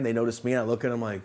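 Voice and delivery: ordinary talk, nothing marked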